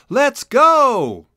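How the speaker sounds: sudden loud excited outburst, high; blurted out. A narrator's reading. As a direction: burst out with it, can't hold it in. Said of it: "blurted out".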